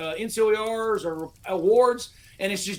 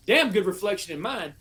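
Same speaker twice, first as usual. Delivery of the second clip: ordinary talk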